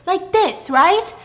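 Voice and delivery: screaming instructions. Retold or imitated. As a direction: scream a demonstration through glass. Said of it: "Tippa's screaming".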